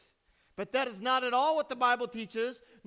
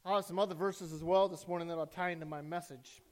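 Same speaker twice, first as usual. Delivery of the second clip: ordinary talk, nothing marked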